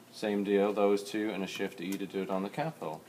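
ordinary talk

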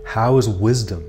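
measured, projected contemplative questions and affirmations; meditation prompts. Acted. Tarot guide voicing the listener's inner questions, solemn meditative delivery. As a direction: pose each thought deliberately, then leave long silence.

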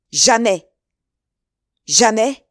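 loud, defiant exclamations; emphatic refusal. A narrator's reading. The duke refuses Felton, loud and emphatic. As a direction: refuse with full force.

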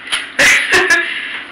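quick airless giggle, high; breathy giggle. Real, not acted. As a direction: laugh at yourself, caught out. Laughs at butter admission.

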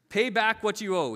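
demanding. A narrator's reading. Servant confronts his debtor, loud acted demand. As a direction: read it as a forceful order. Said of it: "he demanded".

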